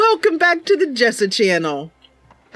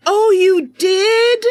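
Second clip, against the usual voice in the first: very high, slow, drawn-out surprise; surprised Mercy; 9 semitones higher, 5 dB louder, much slower than usual. Acted. Mercy hearing that the gifts were lost, slow and surprised. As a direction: gasp it out in wide-eyed sympathy.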